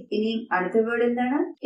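ordinary delivery

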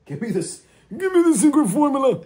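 very high-pitched cartoon villain; Plankton. Retrying a cartoon villain for friends, high mock villain voice. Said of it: "that's not how plankton goes".

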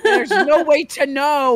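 high, slow, laughing wail; mock helplessness. Past player answering the scolding, mock helplessness. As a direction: draw out a hammed-up helpless plea.

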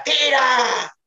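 sudden high yelled command; a teammate's sharp high shout. Retold or imitated. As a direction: yell at him to shoot. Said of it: "oigo un cabrón que grita".

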